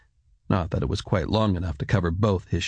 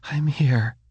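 hushed, higher-pitched mother's whisper; whispered reassurance; 6 semitones higher than usual. A narrator's reading. Mother answers her waking son, reassuring whisper. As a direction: whisper it to comfort a child.

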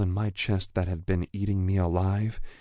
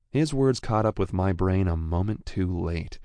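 plain reading voice

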